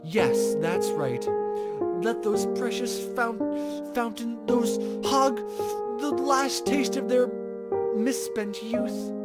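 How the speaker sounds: mock-grand villain monologue with a snicker; plotting villain. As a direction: gloat over your coming revenge. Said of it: "plotting like a villain".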